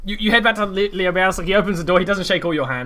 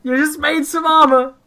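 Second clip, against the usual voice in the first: game master narration; 8 semitones higher, 5 dB louder than usual. Acted. GM scene setting.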